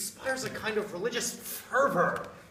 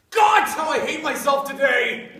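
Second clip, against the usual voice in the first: louder, higher cry then a harsh laugh; self-loathing cry; 7 semitones higher, 10 dB louder than usual. Acted. Man cursing himself before his lover, loud with a bitter laugh. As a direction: explode at yourself, then laugh it off.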